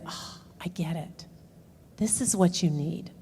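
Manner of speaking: hushed, almost breathless understanding; husband quietly getting it at last; soft, hushed realization. Retold or imitated. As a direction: let it dawn on you, barely voiced. Husband finally understands wife.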